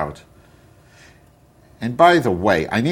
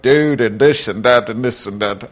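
lightly mocked middle American dude accent; middle American guy accent; 3 semitones higher, 5 dB louder than usual. Podcaster mocking guys on podcasts, middle American accent. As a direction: briefly imitate a middle American guy, louder. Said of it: "middle American guy accent".

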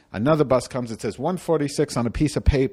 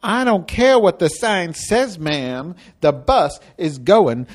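slow, high, talking down; bus driver voice; 6 semitones higher, 4 dB louder, slower than usual. Retold or imitated. Driver dismissing the complaint, slow and high. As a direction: drag it out, condescending. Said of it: "she said to me".

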